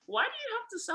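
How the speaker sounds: very high imitation of a puzzled coach; questioning coach. Coach questioning the player who stopped play, high-pitched mock puzzlement. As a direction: mimic a bewildered question, pitch jumping up.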